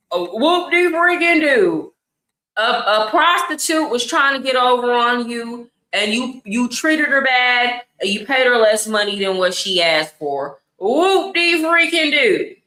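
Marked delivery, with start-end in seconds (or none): 0.1-1.9 slow, mocking "whoop-de-freaking-do" drawn out; sarcastic. Real, not acted. Host mocks a man bragging online, sarcastic. 10.8-12.6 slow, mocking "whoop-de-freaking-do" drawn out; sarcastic. Real, not acted. Host mocks a man bragging online, sarcastic.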